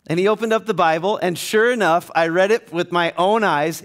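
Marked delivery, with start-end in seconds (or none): none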